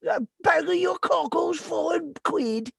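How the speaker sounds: high hammed-up Cockney English accent; mock Cockney accent. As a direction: play a street seller in a Cockney English accent. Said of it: "the English that no, even the English doesn't like".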